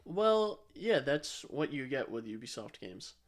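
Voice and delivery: normal talking voice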